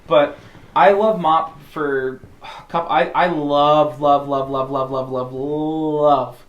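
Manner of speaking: loud, drawn-out repeated love; excited gushing. Real, not acted. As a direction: gush over it like a fan. Gushing about loving the game.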